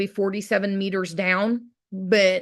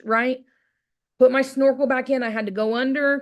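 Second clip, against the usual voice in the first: usual speaking voice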